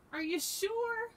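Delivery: amused question. A narrator's reading. Hedgehog doubts frightened Frog's story, lightly amused. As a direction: ask it high, as if tickled. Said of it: "laughed Hedgehog".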